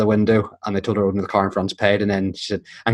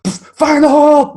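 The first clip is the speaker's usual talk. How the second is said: very high, loud, drawn-out yell; shouted; 7 dB louder, slower than usual. Retold or imitated. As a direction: holler it like a warning. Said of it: "I think he shouted".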